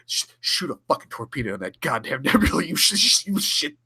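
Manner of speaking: hammed-up fast barked command, laughing through it; mock angry order. Host imitating Kang ordering Tuvok to fire, mock angry.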